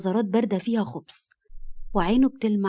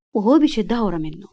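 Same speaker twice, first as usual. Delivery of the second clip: plain reading voice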